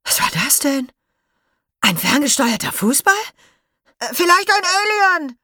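put-on high, loud bewildered children's voices; baffled kids. Schoolkids wonder what the ball was, high and bewildered. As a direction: gawp and guess wildly.

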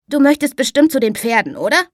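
put-on a lively boy cutting in on his father; bright boy's voice. Boy telling his father who the girl is, high and bright.

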